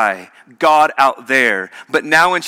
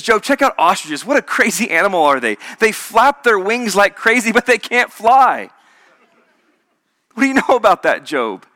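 amused wonder; 5 semitones higher than usual. Pretend. God marvelling at ostriches.

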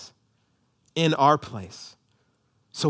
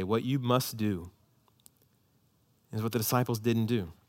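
usual speaking voice

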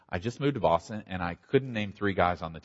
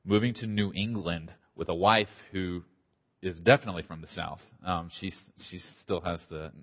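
usual speaking voice

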